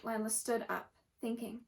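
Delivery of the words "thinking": ordinary delivery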